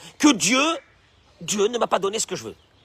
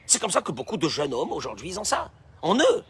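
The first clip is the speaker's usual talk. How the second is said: normal talking voice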